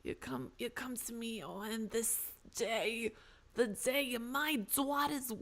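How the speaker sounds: slow, soft mafioso voice; drawn-out mob boss delivery; American mafioso. Acted. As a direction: play a mafia don, taking every word slowly. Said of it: "like, an American mafioso".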